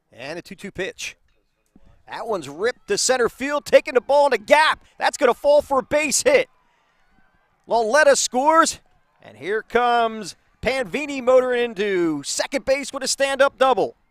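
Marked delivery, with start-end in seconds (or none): 2.1-14.0 rising, loud play-by-play call; live base hit call, voice up and loud; excited call. Real, not acted. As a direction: build the call as the ball drops in.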